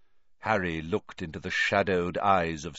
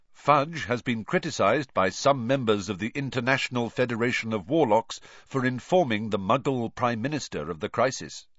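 usual speaking voice

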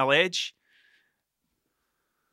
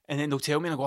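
ordinary talk

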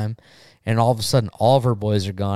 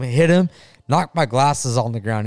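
ordinary delivery

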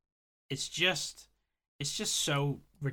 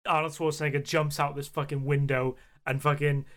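usual speaking voice

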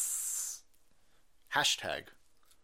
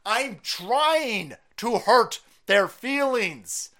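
"I'm trying to hurt their feelings" slow and punched, each word leaned on; slow emphatic; 5 semitones higher, 5 dB louder, much slower than usual. Real, not acted. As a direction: drag it out, louder, daring them. Defiant rant at critics.

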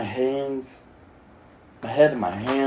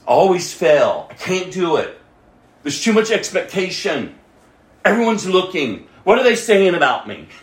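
voicing the fearful thoughts louder and higher; pleading self-doubt, raised; anxious inner voice; 4 semitones higher, 6 dB louder than usual. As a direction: blurt out the worries one after another.

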